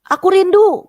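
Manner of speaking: high, loud cry; sudden high-pitched yell; retold scream. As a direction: cry it out at the top of the voice, imitating her. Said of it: "katanya dia teriak-teriak begitu".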